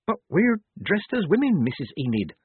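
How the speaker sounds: high start, dropping away meekly; timid, mild protest. A narrator's reading. Polly answers the laundry mistress back, meekly. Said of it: "said Polly meekly".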